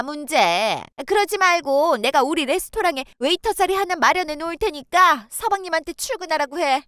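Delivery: ordinary talk, nothing marked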